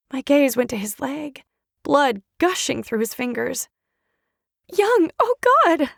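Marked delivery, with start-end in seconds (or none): 4.7-5.9 high, slow, louder cry of horror; anguished cry. Acted. Charlotte sees Young's bleeding leg, high anguished cry. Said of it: "I cried".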